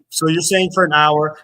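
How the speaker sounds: loud, argumentative restating; heated challenge. Real, not acted. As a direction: raise your voice to challenge the claim. Challenging the other's claim, arguing.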